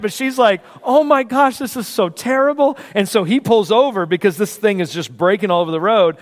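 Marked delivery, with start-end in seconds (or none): 0.8-2.8 high-pitched, horrified sympathy; acted distress, pitched up; dismayed wife. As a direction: imitate a wife fretting over a disaster. Wife's dismay at the accident.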